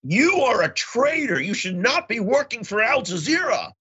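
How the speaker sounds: high, slow, accusing stranger voice; hostile accusation. Retold or imitated. Stranger on the subway accuses him of betrayal, high, slow and hostile. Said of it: "some guy came up to me and said".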